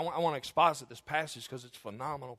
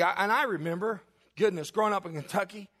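ordinary talk

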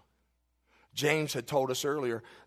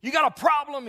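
yelled much higher and louder, a furious stranger; angry yell; 13 semitones higher, 9 dB louder than usual. Retold or imitated. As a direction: bark it at the preacher like a man itching to fight. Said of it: "he yells at my preacher".

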